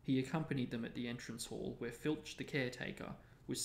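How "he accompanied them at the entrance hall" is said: plain reading voice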